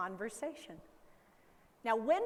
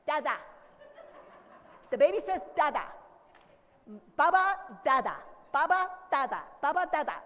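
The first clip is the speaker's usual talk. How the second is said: put-on louder, higher sing-song baby syllables; baby's first words; 3 semitones higher, 6 dB louder than usual. Shown as a demonstration. Coaching a baby toward first words, sing-song. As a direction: coax the baby words out brightly. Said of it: "what does the baby say".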